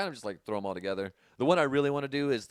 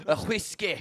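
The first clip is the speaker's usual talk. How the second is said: higher-pitched Scottish promo voice; mock Scottish accent; 5 semitones higher, 3 dB louder than usual. Scottish Stone Cold adding whiskey, mock Scottish accent. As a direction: call the order as a hammed-up Scot.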